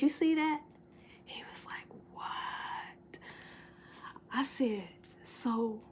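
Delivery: hushed whisper to her son. Real, not acted. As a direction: whisper so nobody outside hears. Hushed talk with son at window.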